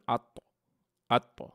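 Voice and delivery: আত with the final ত bounced out sharply; আত, the ত knocked loose with a little bounce; qalqala bounce. Shown as a demonstration. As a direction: give আত a clear echoing release on the ত. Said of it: "এই বাড়ি দেওয়াটাকেই বলে কলকলা".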